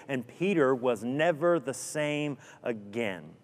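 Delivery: ordinary delivery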